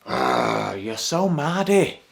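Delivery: loud Yorkshire scold at a moody mate; exasperated shout. Shown as a demonstration. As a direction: yell it at a sulking friend. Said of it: "you would describe them as mardy".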